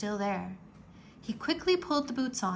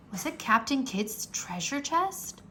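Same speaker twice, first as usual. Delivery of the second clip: ordinary delivery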